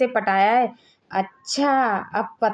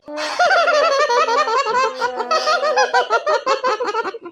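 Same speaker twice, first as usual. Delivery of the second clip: high burst of mocking laughter; 9 semitones higher than usual. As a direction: laugh hard at her look. Laughing at wife's outfit. (ज़ोर की मज़ाक उड़ाती हँसी)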